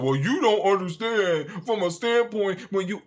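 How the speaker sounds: high, drawn-out mimicry; mocking commenter voice. Creator mimics online commenters who argue back, mocking. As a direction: drag it out in a mocking high whine.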